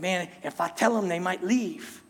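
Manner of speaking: muttered to yourself, half under the breath; anxious aside, quietly voiced; worried inner thought. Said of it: "And you're like".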